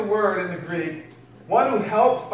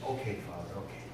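quiet reply; 12 semitones lower, 12 dB quieter, faster than usual. Acted. Jesus answers Father, quiet.

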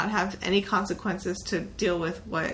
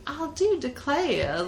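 mock Georgia accent; 6 semitones higher, much slower than usual. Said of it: "Georgia's like".

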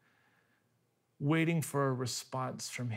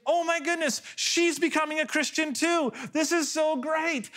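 much higher, thrilled inner voice; overjoyed thoughts; 14 semitones higher, 3 dB louder than usual. Retold or imitated. Husband thinking his crying wife just found Jesus, overjoyed. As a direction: burst with delight at the good news.

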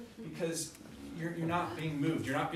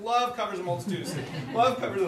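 higher, louder, rushed repeating of a mantra; nervous hammed-up chant, rising and louder; panicked dodging; 4 semitones higher, 7 dB louder, faster than usual. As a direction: gabble it faster and louder as the monster looms. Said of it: "You're like".

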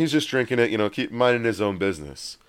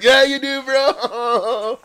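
hyped-up imitation of cheering friends; whooping hype; 13 semitones higher, much slower than usual. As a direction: egg on a buddy with a high, drawn-out whoop. Friends cheering him on, acted.

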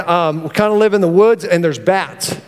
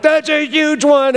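excited yell; 8 semitones higher than usual. Retold or imitated. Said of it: "'Cause we're yelling".

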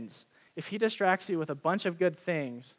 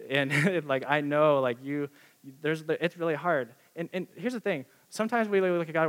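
normal talking voice

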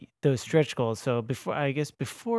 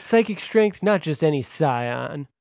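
loud, raised dramatic announcer; radio announcer voice; 2 semitones higher, 6 dB louder than usual. Pretend. Host announcing the psychic class deck, radio voice. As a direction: proclaim it like a trailer voice-over. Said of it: "make you read them all that way".

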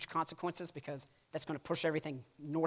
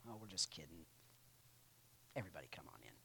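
hushed, lower whispered joke; mock whisper; 9 semitones lower, 16 dB quieter, much faster than usual. First Diet of Speyer takes back the edict in a mock whisper. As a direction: whisper it like a sly take-back. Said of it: "first Speyer says".

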